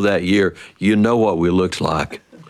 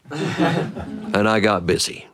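ordinary delivery

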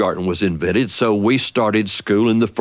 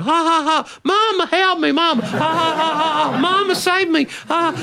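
put-on much higher, childlike laugh-cry; little boy half laughing. Little brother chased by dad, high laugh-crying. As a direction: squeal for mama between laughs.

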